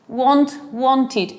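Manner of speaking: "wanted" with a clearly sounded "id" ending; bare "Want", then "wanted" with "id" added; extra "id" syllable. Shown as a demonstration. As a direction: say "wanted" in two beats, want-id. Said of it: "agregar la pronunciación id".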